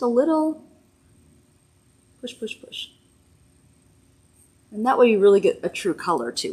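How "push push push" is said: whispering. Real, not acted. Concentrating on applying.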